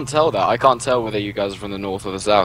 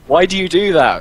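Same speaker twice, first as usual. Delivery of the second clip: baffled protest; 8 semitones higher, 4 dB louder than usual. Retold or imitated. Exasperated at friend's accent switching.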